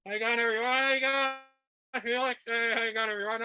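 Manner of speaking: high muffled call; muffled mask voice. Pretend. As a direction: call hello from inside a feeding bag. Greeting audience from inside feeding-bag mask.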